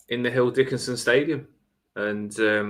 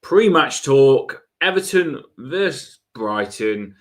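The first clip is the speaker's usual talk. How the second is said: show host intro; 3 semitones higher, 7 dB louder than usual. Acted. Podcast intro greeting.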